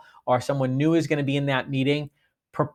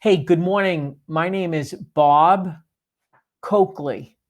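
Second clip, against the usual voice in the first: slow, with a clear pause between "Bob" and "Coakley"; the right way, name spaced out; deliberate pause; 2 semitones higher, slower than usual. Shown as a demonstration. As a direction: say "Bob", stop, then "Coakley". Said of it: "just be super deliberate".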